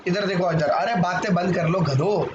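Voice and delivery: ordinary talk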